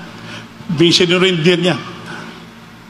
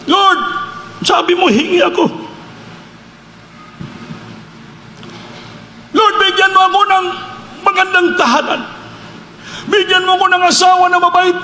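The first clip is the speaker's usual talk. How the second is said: fervent pleading prayer; 8 semitones higher than usual. Retold or imitated. Retold desperate prayer to God.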